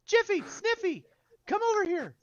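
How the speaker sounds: very high falsetto summons; loud, high airy call; shopkeeper calling out. Acted. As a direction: call helpers over in an excited, high put-on voice. Shopkeeper calls her helpers over.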